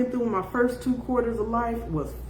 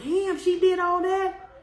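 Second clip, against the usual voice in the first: mock surprise; 8 semitones higher, slower than usual. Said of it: "And I'm like".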